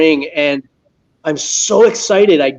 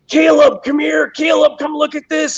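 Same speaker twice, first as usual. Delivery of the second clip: retold yelling; 10 semitones higher than usual. Dad calling his son over from the end zone, high mock yell. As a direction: call his name across the field in a mild mock yell. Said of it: "And I start yelling".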